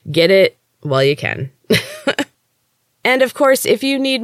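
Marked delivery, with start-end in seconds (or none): 1.7-2.2 quick high laugh; a light real laugh; short laugh. Real, not acted. Laughs after the ad read.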